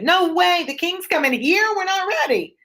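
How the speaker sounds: high, panicky no way; panicked squeal. Pretend. Teacher voicing a flustered Lady Macbeth, high panicky squeal.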